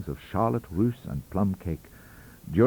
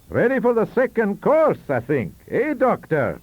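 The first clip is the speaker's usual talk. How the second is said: put-on high, hearty old statesman, raised voice; Sir John's voice; 12 semitones higher, 7 dB louder than usual. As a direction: play the jovial old Prime Minister calling for dessert. Narrator voices Sir John.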